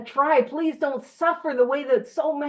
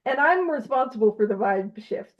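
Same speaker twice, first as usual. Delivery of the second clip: ordinary delivery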